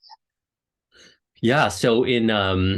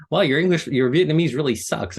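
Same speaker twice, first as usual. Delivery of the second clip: mock parent; 9 semitones higher, 5 dB louder than usual. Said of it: "they're like".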